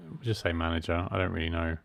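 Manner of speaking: usual speaking voice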